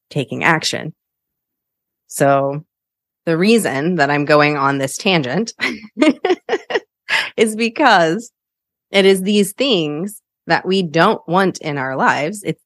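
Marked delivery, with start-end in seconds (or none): 6.0-6.8 light laugh. Real, not acted. Laughs at own tangent.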